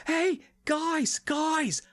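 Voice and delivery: high, drawn-out squeaky Lara announcing her find; a high, hammed-up Lara bursting in on the Polynesians. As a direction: shout the news proudly in a high mock Lara voice.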